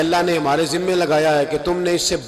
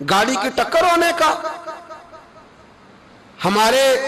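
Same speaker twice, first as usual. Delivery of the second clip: much higher, urgent rhetorical questions; impassioned preaching; 8 semitones higher than usual. Acted. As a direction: hammer the congregation with each question. Preacher confronts congregation. (بلند اور پرجوش خطیبانہ سوال)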